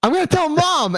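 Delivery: high-pitched mock tattletale threat; high, hammed-up tattling; tattling brother. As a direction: play a sibling threatening to tell.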